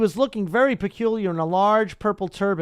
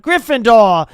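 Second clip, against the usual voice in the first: loud announcing shout; hat's triumphant shout; 3 semitones higher, 8 dB louder, slower than usual. A narrator's reading. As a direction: call out the house name. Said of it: "When it finally shouted".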